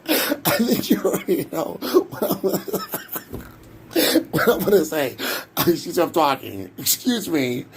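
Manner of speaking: usual speaking voice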